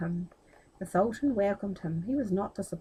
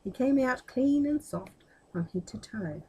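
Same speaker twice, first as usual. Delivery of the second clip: ordinary delivery, nothing marked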